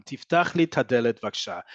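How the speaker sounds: 'et ha' squeezed into 'ta' in 'tadelet'; elided colloquial Hebrew. Shown as a demonstration. Teacher demonstrating comfortable spoken Hebrew, 'tadelet' elided. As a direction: say 'tadelet' as one run-together word. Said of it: "speak like this".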